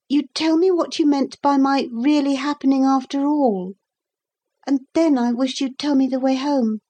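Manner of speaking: put-on high-pitched small boy's voice; pleading boy. A narrator's reading. Boy asking the captain the way home, pleading. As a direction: appeal as a lost boy.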